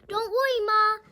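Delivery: high, loud little pig voice; pigs oinking. A narrator's reading. Piglets reassuring their mother, high and loud. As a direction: squeal it like a piglet. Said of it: "they oinked".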